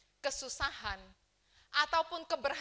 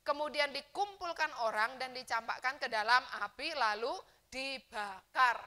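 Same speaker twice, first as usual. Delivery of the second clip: preaching scripture reading; 8 dB louder than usual. Acted. Pastor reading the Bible verse aloud to the church, preaching loudly. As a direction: proclaim it to the whole congregation.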